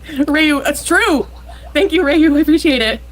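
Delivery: high, loud appreciative burst; excited thanks. Real, not acted. Streamer thanking a donor, high and excited. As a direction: gush thanks at a supporter.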